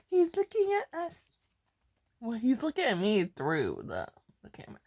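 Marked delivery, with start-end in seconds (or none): 0.1-1.3 high, excited squeal; giddy squeal. Real, not acted. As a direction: squeal it, delighted.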